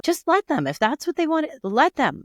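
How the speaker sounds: high-pitched exasperated daughter voice; mock daughter's high-pitched insistence; insistent daughter. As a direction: plead from way up high, as the retold daughter. Retold daughter interjecting again.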